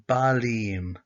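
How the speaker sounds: balim said slowly with the ending im drawn out; slow teaching demo. Shown as a demonstration. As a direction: spell out balim for learners. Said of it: "now we add on our ending".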